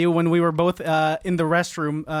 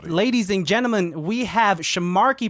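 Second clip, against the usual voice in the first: announcer introduction; 3 semitones higher, 3 dB louder, slower than usual. Pretend. Host presenting a special guest to listeners, announcer hype. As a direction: hype announcer stretching out the final words.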